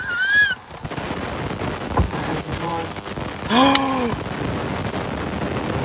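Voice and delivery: long, high intake of breath; shocked gasp. Real, not acted. Driver hearing the car speak, gasping in shock.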